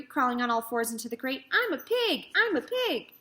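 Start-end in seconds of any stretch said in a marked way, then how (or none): 1.5-3.1 put-on high, gleeful boy's shout; excited boy playing. A narrator's reading. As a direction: crow it like a silly kid. Boy pretends to be pig.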